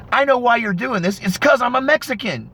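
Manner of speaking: high-pitched mock protest; retold yell. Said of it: "he yells".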